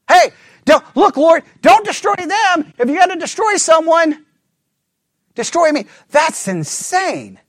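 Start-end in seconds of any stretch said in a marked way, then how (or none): 0.1-5.9 pleading Moses. Acted. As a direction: beg God to spare them and take you instead.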